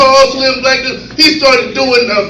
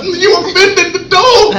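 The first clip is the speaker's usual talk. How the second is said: higher, slow, indignant scolding; mock offended dog; 7 semitones higher, slower than usual. Comic scolding the owner, mock outrage. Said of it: "the little dog is like".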